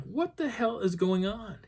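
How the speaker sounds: high, drawn-out disbelief; bewildered. Retold or imitated. Hiker alone after everyone bolted, high-pitched bewilderment. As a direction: stretch it out in high bafflement.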